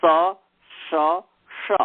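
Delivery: स, श and श each voiced apart as distinct hisses; teacher's sibilant contrast; three sibilants demo. Shown as a demonstration. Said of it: "तीन स है".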